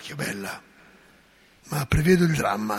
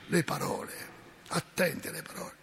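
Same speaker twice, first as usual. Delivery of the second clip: hushed warning; 2 semitones lower, 10 dB quieter, faster than usual. Shown as a demonstration. Priest warning his flock, echoing himself in a hush. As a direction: drop to a near whisper on the repeated warning.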